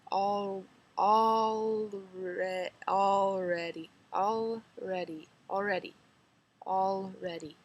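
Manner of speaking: "already" drawn out slowly, vowels stretched; trying the local way. Shown as a demonstration. Vlogger trying the local "already" slowly. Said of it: "I can't say it even".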